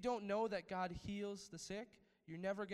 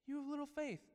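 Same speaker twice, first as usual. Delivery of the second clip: a hint of a stern Jesus, voiced higher; higher mock rebuke from Jesus; Jesus rebuking; 10 semitones higher than usual. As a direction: lightly play Jesus scolding his disciples. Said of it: "what did Jesus say to them".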